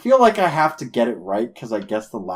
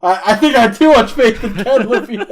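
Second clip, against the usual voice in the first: laughing; 12 semitones higher, 8 dB louder than usual. Real, not acted. Laughing at own guess.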